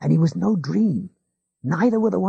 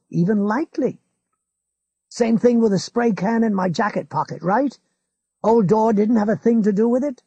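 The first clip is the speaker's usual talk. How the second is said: lightly raised, insistent; Ralph insisting; 7 semitones higher, 5 dB louder than usual. A narrator's reading. Ralph insists to his neighbour, mildly urgent. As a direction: press the point a touch higher.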